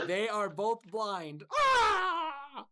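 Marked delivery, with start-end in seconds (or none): 1.5-2.6 sharp, high, loud cry of pain; sudden shriek of a blinded wizard; wizard's pained yelp. Acted. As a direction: yelp as if your eyes burn.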